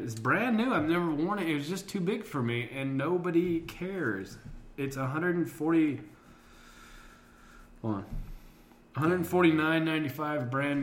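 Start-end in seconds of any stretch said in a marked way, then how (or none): none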